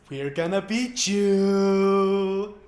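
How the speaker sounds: loud, slow cartoon pirate boast; mascot voice. Voicing the Buccaneer mascot.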